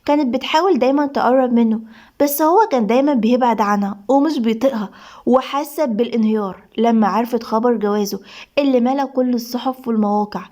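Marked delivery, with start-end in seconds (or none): none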